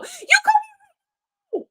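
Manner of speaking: very high, slow, sing-song call; silly high call. Shown as a demonstration. Teacher showing the wrong way to scold, very high. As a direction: float it up high and sweet. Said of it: "your voice doesn't go".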